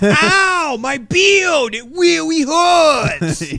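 put-on high, loud, slow kid cry; shout-whine like a hurting four-year-old; childish yell of pain over a beard; whining bearded toddler. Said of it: "impression of a four-year-old with a full beard".

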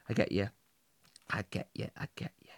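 repeated, fading into a whisper. Acted. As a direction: let it die away to breath. Repeating until it fades out.

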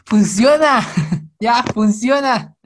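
slow, loud, laughing "it works!"; laughing excitement. Real, not acted. Psychologist leaning into the camera to viewers, laughing loud. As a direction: drag out funciona while laughing.

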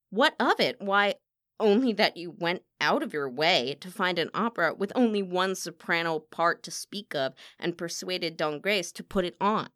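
loud, incredulous echo, then accusation; scathing, forceful diva; indignant accusation. Acted. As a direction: throw the question back angrily. Rival throws question back, accusing.

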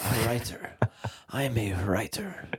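put-on soft hushed English accent; breathy mock-posh English accent; whispered English accent mock. Mocking his accent, whispered.

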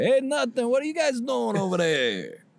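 ordinary talk, nothing marked

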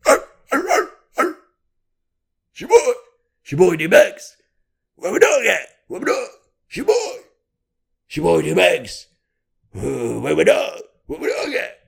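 high, loud growling barks and rap ad-libs. Retold or imitated. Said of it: "impressing fucking D M X".

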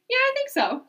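squeaky mock-innocent answer; unsure friend. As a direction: mimic a novice's airy uncertainty.

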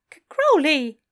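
high, loud, stammered protest; startled exclamation. Acted. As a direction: stammer the name out in alarm. Startled angel cuts him off.

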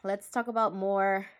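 ordinary talk, nothing marked

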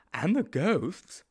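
high-pitched, naive sidekick; high storybook character voice; Sponge's character voice. A narrator's reading.

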